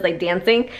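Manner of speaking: ordinary talk, nothing marked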